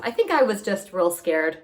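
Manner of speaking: usual speaking voice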